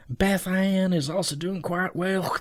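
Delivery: a lightly put-on half British, half American accent. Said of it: "half British, half general American accent".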